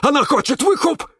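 high, loud wailing cry; wailing cactus. Acted. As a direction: wail it out in panic. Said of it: "завопил Кирилл". (вопль кактуса, высоко и громко)

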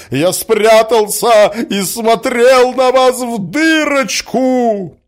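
high, loud, frantic insistence; rash blurting. A narrator's reading. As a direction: blurt out the secret at full voice. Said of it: "брякнул Мозгляков в затмении ума своего". (сгоряча, громко выпалить)

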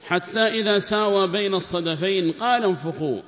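Quran recitation. Acted. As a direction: intone the verse with full voice. (تلاوة قرآنية بصوت عالٍ مرتفع)

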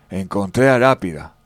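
ordinary talk, nothing marked

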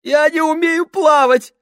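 high, loud panicked cry; sobbing in fear. A narrator's reading. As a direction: cry it out in terror. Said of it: "всхлипнул Сольц".

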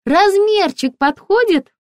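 very high, chirpy shop assistant; high-pitched, ringing sales voice; ringing saleswoman voice. A narrator's reading. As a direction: pipe up brightly through the door. Ringing-voiced saleswoman calls through door.

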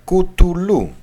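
Κουτουλού as "koo-too-LOO", stressed on the last syllable; Greek abbreviation reading. Shown as a demonstration. Lecturer reading out the Greek for et cetera, abbreviation pronunciation. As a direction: hit the final syllable of Κουτουλού. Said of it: "την ελληνική συντομογραφία".

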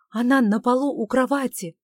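breathless, loud blurted news; blurted alarm. Acted. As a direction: burst out with the shocking news. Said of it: "выпалил он".

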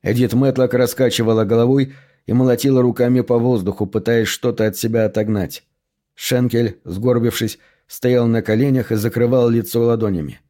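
ordinary delivery

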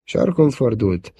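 ordinary delivery, nothing marked

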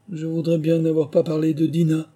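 high-pitched, regretful lament; sad. A narrator's reading. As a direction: regret it aloud to yourself, voice lifted. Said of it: "se dit-elle tristement".

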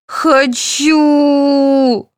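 put-on high, drawn-out childish wail; wailing little girl. A narrator's reading. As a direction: whine it out like a small child in a tantrum. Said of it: "рыдала Светлана".

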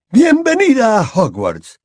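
Dumbledore voiced.

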